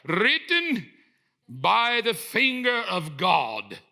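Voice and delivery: booming, very slow proclamation; Moses. Acted. Leader back from the mountain addressing his people, grand booming proclamation. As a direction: proclaim it grandly, stretching every word. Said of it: "I don't know if that's how he sounds".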